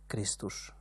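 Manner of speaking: soft, low, simple reply; quiet answer. A narrator's reading. Shepherd answering the raging old man, quiet. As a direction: answer calmly, almost under the breath. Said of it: "felelte Manolius".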